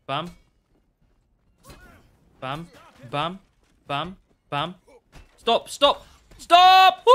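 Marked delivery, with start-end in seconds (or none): 5.4-7.0 builds from a loud stop to a very high, loud scream; panicked shout. Real, not acted. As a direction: yell at the enemy to quit hitting you. Enemy attacking him in-game.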